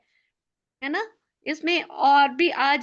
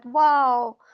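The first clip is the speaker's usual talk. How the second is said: delighted wow; 3 semitones lower, 4 dB louder, much slower than usual. Shown as a demonstration. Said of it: "इतनी बड़ी smile के साथ".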